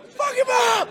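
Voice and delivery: a high, loud jeering shout from the crowd; high mocking yell from the stands; crowd heckle. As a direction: bellow it like a rowdy fan. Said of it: "just so I go".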